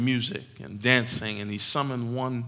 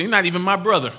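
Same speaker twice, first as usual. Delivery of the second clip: scornful; 6 semitones higher, 6 dB louder, faster than usual. Acted. Older son disowning his brother.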